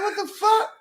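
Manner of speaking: high, laughing startled what the fuck; jolted awake by a bump, half laughing; laughing high-pitched shock; startled outburst. Retold or imitated. Startled by car hitting him.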